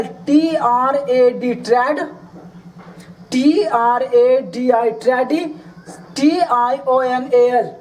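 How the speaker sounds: letters drawn out one at a time; very slow spelling. Shown as a demonstration. Teacher spelling traditional very slowly for students. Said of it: "teacher spells traditional slowly".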